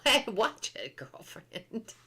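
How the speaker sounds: sassy warning through a laugh; giggly mock threat to a friend; laughing sass. Mock warning a chat friend, sassy and giggling.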